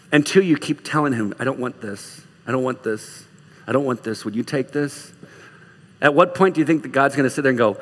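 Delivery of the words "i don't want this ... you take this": low, soft plea; quiet pleading prayer. Acted. Believer keeps telling Jesus about the weight, quiet pleading. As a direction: hand it over softly, as in private prayer.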